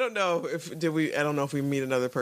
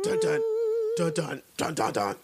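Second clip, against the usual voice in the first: ominous music imitation. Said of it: "the ominous music".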